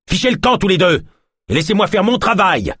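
high, loud order to leave; angry shout. A narrator's reading. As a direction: drive them out shouting. Healer throws them out.

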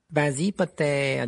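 slow, unhurried, level dare; calm challenge. A narrator's reading. Malfoy dares Harry to attack, calm. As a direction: dare him calmly, drawing it out. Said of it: "dit tranquillement Malfoy".

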